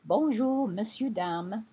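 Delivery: cheerful French hello to a shopkeeper; French greeting. Shown as a demonstration. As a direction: greet the shop clearly in French. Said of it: "So you always say".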